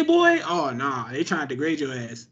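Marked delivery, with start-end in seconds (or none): 0.0-0.4 put-on "boy" pitched much higher and louder, drawn out with contempt; degrading boy. Shown as a demonstration. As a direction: sneer the word "boy" to put someone down. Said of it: "How they say".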